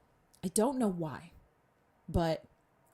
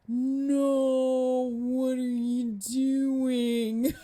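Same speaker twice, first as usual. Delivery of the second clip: drawn-out, dragged, loud and higher, like slow motion; slow-motion shout; 3 semitones higher, 5 dB louder, much slower than usual. Retold or imitated. Reliving the crash, shouting at the driver in slow motion. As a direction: stretch every word as if time slowed. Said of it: "it sounded really slow".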